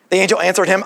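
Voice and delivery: usual speaking voice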